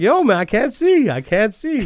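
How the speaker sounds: very high, loud, slow whining excuse; Raven's loud excuse. Retold or imitated. Raven protesting he can't see, loud and high. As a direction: wail the fake excuse up high.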